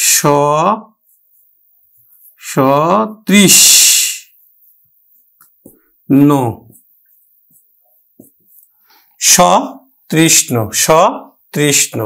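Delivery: সতৃষ্ণ dragged out with open স; very slow model word. Shown as a demonstration. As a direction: stretch সতৃষ্ণ, স kept as অ. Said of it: "সটার উচ্চারণ স এর মতোই হবে".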